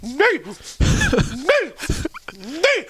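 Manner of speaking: very high squawking cries of Knight; high-pitched repeated yell, imitating a buddy; shrieking bird call. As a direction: screech it like a wild bird. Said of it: "my one Knight buddy of mine starts going".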